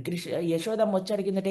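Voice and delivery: ordinary delivery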